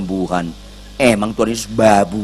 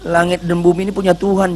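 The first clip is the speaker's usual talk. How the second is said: ordinary talk, nothing marked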